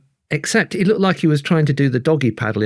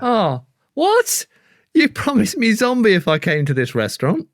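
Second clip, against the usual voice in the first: indignant diner; 8 semitones higher than usual. Acted. Outraged diner in sketch.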